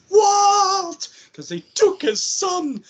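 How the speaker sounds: very high, loud, drawn-out mock cry; imitated desperate yell. Mimicking Michael looking for his son, imitated desperate yell.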